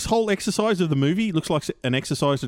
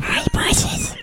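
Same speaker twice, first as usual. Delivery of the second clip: high rasping whispered creature voice; covetous, unvoiced high-pitched croak; Gollum; much slower than usual. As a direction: squeal it in a strangled whisper. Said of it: "cracking off about your Precious".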